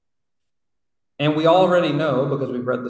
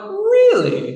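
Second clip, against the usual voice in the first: very high, louder disbelieving really; mock shocked disbelief; incredulous really; 7 dB louder than usual. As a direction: shoot it up in utter disbelief. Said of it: "And I'm like".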